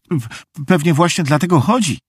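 high, hurried anxious wail; whining. A narrator's reading. Meg worries the tramp is prowling, whining. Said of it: "zajęczała Meg".

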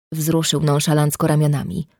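usual speaking voice

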